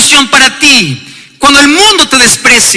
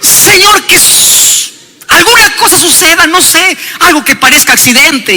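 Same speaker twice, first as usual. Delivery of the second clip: higher, quicker, hammed-up plea for a mishap; pleading in a higher, faster mock voice for harm; mock sly prayer; 5 semitones higher than usual. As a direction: play a spiteful believer hinting at an accident. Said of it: "hay muchos que sí oran".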